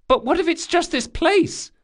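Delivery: put-on high-pitched nervous boy; young lad, worried; anxious boy voice. Bug the boy interrupts.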